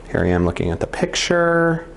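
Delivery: normal talking voice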